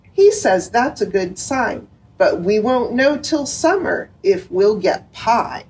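lively storybook reading aloud; reading to children. A narrator's reading. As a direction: read it to little kids, bright and gentle. Second picture book read aloud.

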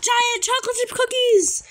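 high-pitched, delighted exclamation; excited squeal. Real, not acted. Player spotting giant cookies, excited squeal. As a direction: squeal with glee at a treat.

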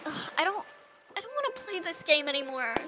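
soft, dejected mumble after a prank; hurt withdrawal. Acted. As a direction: back out quietly, wounded. Hurt girl quits the game.

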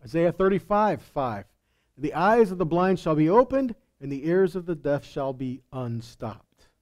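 ordinary talk

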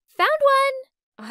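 high, loud excited call across the room; excited shout. Retold or imitated. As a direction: shout it over the party noise, thrilled. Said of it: "excitedly waved at me and shouted over".

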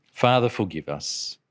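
usual speaking voice

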